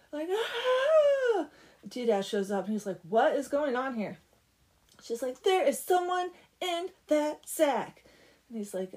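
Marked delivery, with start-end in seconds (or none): 0.3-1.5 loud, high-pitched scream; long high shriek, acting out panic; mock scream. Girls fleeing the opened bag, mock scream. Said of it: "start screaming and running". 3.1-4.2 louder, indignant demand; mock demanding. Doodad walking in on the screaming, loud demand. 5.4-8.0 loud, high, slow alarm; mock alarm. Frightened girl warning her dad, mock alarm. Girl reporting man in sack.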